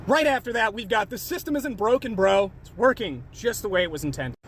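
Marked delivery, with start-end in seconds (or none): 1.1-4.4 low, laid-back commenter voice; commenter impression. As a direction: drop low to mimic a know-it-all commenter. Reading a commenter in character.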